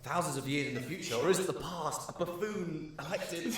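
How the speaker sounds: mock-epic, cracking up on the last words; amused mock prophecy. Game master imagining democracy's future, mock-epic and laughing.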